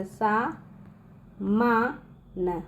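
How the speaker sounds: slow, split into separate syllables. Shown as a demonstration. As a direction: spell it out slowly for the kids. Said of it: "तो किस तरह लिखेगा".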